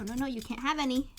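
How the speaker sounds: ordinary delivery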